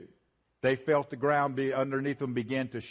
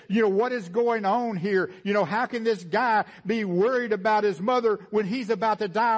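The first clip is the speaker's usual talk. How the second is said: loud, higher preaching voice, slowing at the end; preaching at full force; 7 semitones higher, 5 dB louder than usual. Acted. Preacher to congregation, about the centurion: full-force preaching. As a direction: drive it home from the pulpit.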